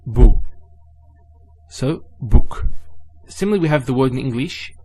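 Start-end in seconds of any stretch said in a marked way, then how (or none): 0.1-2.7 bu punched out loud and short; stressed bu. Shown as a demonstration. Teacher drills the bu vowel for learners, louder. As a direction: hit the bu sound. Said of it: "would be bu".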